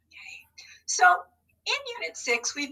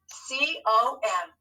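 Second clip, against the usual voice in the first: C, O, M slowly, letter by letter; spelling aloud; much slower than usual. Shown as a demonstration. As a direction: spell out C... O... M at half pace. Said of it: "how are we gonna spell com".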